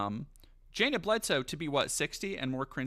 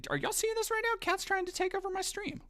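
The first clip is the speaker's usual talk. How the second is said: much higher, squeaky disbelief; incredulous squeak. Real, not acted. Streamer to chat about the cat, high squeaky disbelief.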